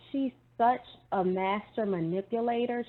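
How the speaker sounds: usual speaking voice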